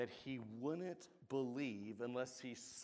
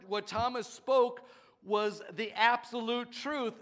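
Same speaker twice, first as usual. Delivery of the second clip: loud, higher preaching build; full-force preaching; 7 semitones higher, 10 dB louder than usual. Acted. Preacher driving the warning home to the congregation, loud. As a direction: preach it to the rafters.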